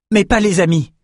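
high-pitched protest; objecting. A narrator's reading. Young fighter refuses to fight his friend, objecting. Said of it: "objecta Bannon".

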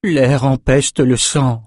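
slow, mildly pained moan. A narrator's reading. Sorceress among the bloody corpses, slow mild moan. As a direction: draw it out in weary disgust. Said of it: "gémit Elsa".

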